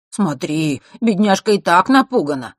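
syrupy, insincere solicitude; falsely caring. A narrator's reading. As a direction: fake pity for the poor thing. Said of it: "его фальшиво-заботливого голоса".

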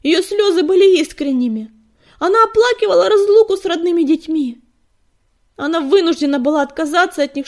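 high, loud, impassioned defence; heated protest. A narrator's reading. Fireheart defends his leader's tears, impassioned. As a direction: plead her case fervently at full voice. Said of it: "горячо воскликнул он".

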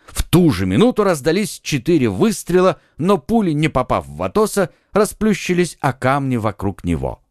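plain reading voice

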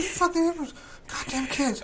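high mock drunk grumble; half drunk grumble. Picturing himself half drunk: high grumbling sneer. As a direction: play the half-drunk grouch. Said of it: "half drunk, going".

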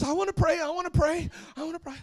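put-on very high, insistent little-girl voice; clamoring child. Daughter wanting Daddy's attention, high loud clamor. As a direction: pester like a kid demanding a turn.

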